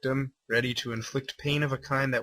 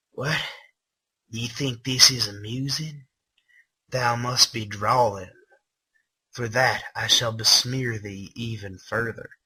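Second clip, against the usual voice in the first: lightly voiced sneering villain; mock Old English menace; archaic villain accent. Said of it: "I started giving him that accent".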